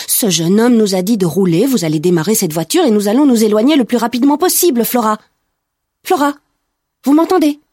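insistent, rising in pitch and force; pressing orders, ending high and loud; urgent insistence. A narrator's reading. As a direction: try to shake someone into action. Shaking a frozen driver into action.

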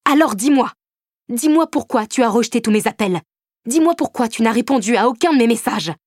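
angry man demanding answers; furious demand. A narrator's reading. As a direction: press her hard, repeating.

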